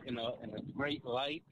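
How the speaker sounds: ordinary delivery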